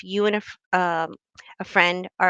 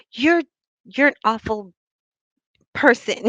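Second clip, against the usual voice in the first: mock insult; 3 semitones higher, 6 dB louder than usual.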